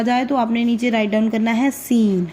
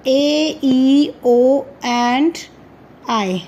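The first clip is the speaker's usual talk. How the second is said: drawn-out vowels; much slower than usual. Shown as a demonstration. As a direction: stretch the vowel names A, E, O and I very slowly. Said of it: "teacher stretching the vowel names". (स्वर धीरे-धीरे खींचकर)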